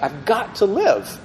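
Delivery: a frightened prisoner pleading through tears; mild retold sobbing plea; pleading, near tears. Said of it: "he starts crying".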